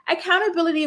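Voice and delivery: high, disbelieving echo of his word; incredulous. Retold or imitated. Vlogger mocking a cast member's demand, incredulous and high. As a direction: throw the word back in disbelief.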